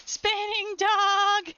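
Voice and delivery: high and drawn-out slow; delighted dog talk. Real, not acted. Watching her dog spin, slow high delighted coo.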